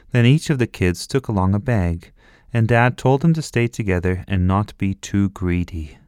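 ordinary delivery

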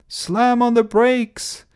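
high, drawn-out alarmed cry. A narrator's reading. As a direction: cry out the panicked order. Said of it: "cried Ernest".

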